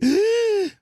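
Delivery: high startled gasp, imitating the old lady; mock shocked gasp. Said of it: "She's like".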